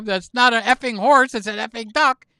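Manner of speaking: acted annoyance, high and loud; exasperated correction. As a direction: punch out the correction indignantly. Wife's line with swearing added.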